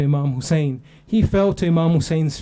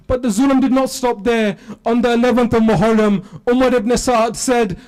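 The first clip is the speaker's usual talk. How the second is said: loud, high sermon climax; fiery majlis preaching; preaching at full force; 5 semitones higher, 3 dB louder than usual. Acted. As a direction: drive the story home to the mourners.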